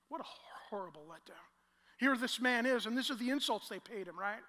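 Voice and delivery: ordinary talk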